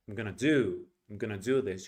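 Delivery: "I'm gonna" squashed, "do this" stressed; English reduced rhythm. Shown as a demonstration. Teacher showing the right English way, reduced "I'm gonna", big "do". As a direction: swallow "I'm gonna" and punch "do". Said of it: "only pronounce the big words".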